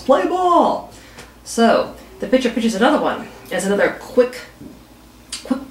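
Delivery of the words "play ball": higher umpire shout, a touch hammed-up; umpire calling play ball. Umpire restarts the game after the strike, mock umpire call. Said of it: "Umpire yells".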